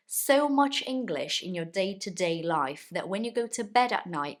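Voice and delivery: usual speaking voice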